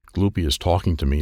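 plain reading voice

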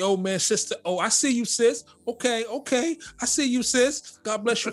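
high, overdone church-sister greeting; mock churchy greeting. Mocking churchgoers who greet a sister in church talk. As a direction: gush like a church greeter, hammed-up. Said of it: "you know all the language".

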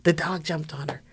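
ordinary delivery

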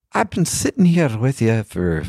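lightly mimicking an old Irish man; bad Irish accent. Old Irish man giving feedback, in a weak Irish accent. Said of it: "I'm not doing a very good Irish accent".

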